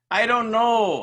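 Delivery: high, loud exasperated retort; fed-up snap. Retold or imitated. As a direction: snap back, sick of the question. Fed-up boss snaps back.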